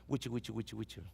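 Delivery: soft whispery wichi-wichi gossip sound; imitated crowd whispering; murmuring noise. Shown as a demonstration. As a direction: mimic the hiss of a murmuring crowd.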